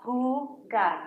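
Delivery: jugar said slowly with an h sound, hoo-gar; correct Spanish J. Shown as a demonstration. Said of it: "So jugar".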